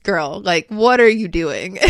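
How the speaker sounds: loud, drawn-out sassy scolding; imitating a sassy spirit guide. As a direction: call someone out with sass, half laughing. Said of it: "my spirit guides are sometimes really sassy".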